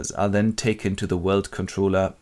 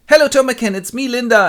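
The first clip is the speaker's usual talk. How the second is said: high, loud hello; excited greeting; 13 semitones higher, 7 dB louder than usual. Acted. Linda greets the director in public, excited and high. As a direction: greet a long-lost lover in a high, excited voice.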